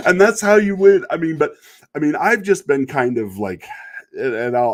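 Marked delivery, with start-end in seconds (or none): 0.1-1.1 triumphant punchline. Real, not acted. Gloating punchline after trolling troll.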